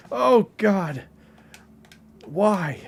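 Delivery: high, pained cry of dismay; exasperated dismay. Real, not acted. Dismayed at sounding racist with accents.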